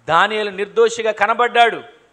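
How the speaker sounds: ordinary talk, nothing marked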